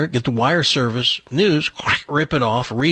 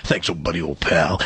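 much lower, booming buddy-buddy baritone; commanding voice; 9 semitones lower, 3 dB quieter than usual. Retold or imitated. Newsman ordering the teen intern to fetch cigarettes, deep commanding voice. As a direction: lay on the deep boss charm. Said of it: "in that commanding voice".

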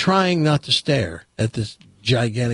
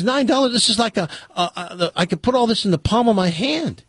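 incredulous; 7 semitones higher than usual. Retold or imitated. Customer outraged at bar prices.

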